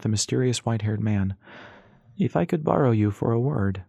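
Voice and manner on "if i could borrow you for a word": lightly voiced stranger echoing his own words; a hint of mocking politeness, borrowed from before; the man repeating his earlier request in a slightly put-on tone; imitating his earlier tone. A narrator's reading. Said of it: "imitating the tone from their previous meeting".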